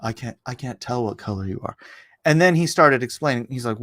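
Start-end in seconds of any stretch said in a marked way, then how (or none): 0.0-1.7 much softer, put-on dopey voice; mocking student voice. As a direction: mimic the clueless kid, deadpan. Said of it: "kid (repeated)".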